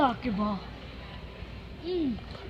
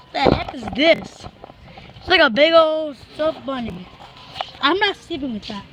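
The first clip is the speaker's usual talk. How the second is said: usual speaking voice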